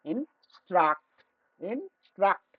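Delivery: Instruct slowly, with a pause after ইন; teacher sounding out instruct in two separate parts; syllable division. Shown as a demonstration. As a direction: split instruct into ইন and struct. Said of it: "syllable division নিয়ে আলোচনা".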